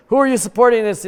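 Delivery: loud, high bouncer interrogating fans at the door; stadium door guard. Pretend. Guard at the stadium door questions a fan, loud and high. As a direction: bark it like a suspicious stadium bouncer. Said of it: "be like".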